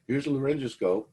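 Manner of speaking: put-on chirpy young nurse. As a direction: hand it over brightly, pleased with yourself. Young lady proudly hands over scope.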